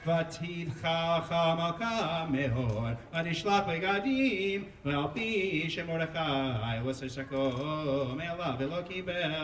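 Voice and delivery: steady liturgical chanting of scripture; a cantor reading the scroll; Megillah cantillation. Acted. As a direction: chant the Hebrew verses in the traditional trope. Chanting Megillah chapter four.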